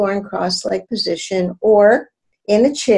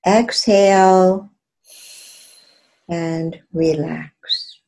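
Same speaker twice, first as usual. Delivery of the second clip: guided meditation. Acted. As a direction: ease the listener into stillness. Leads a guided breathing.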